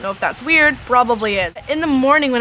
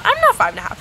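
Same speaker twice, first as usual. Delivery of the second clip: high squeak; 14 semitones higher, faster than usual. Pretend. High squeak correcting herself.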